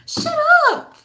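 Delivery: very high, loud mock squeal; exaggerated dismissal. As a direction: shoot way up in pitch, incredulous. Repeated acted dismissal of doubters.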